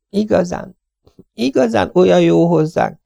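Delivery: high, gentle lady's voice; tired young wife. Acted. Mrs Tenius refusing food from Miss Lawson, high and polite. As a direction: decline politely, worn out.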